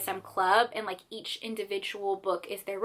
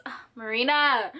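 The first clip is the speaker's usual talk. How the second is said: normal talking voice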